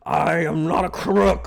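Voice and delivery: a hint of Nixon, deliberate; Nixon impression. Asked by chat for Nixon, lightly voiced. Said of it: "Can you do a Nixon impression".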